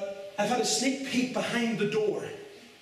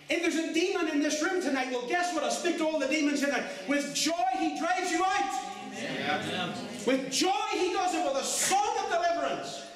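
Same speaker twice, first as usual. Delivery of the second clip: full-force preaching; 6 semitones higher than usual. Acted. Preacher commanding demons, in a rising joyful cry.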